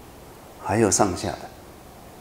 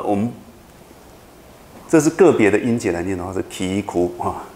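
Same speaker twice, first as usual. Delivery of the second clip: usual speaking voice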